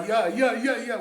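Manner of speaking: high, loud mock cries; imitated fan shouting. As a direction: mimic someone crying out in the street. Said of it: "tu cries comme ça". (cris imités, aigus et forts)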